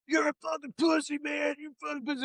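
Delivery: high, strained mocking boot camp screamer; mock drill sergeant yell. Podcaster mocking a boot camp instructor screaming at a recruit, high strained mock yell. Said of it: "They're screaming at the goat".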